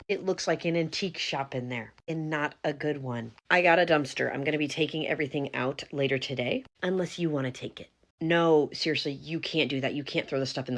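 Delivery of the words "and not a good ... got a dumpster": ordinary talk, nothing marked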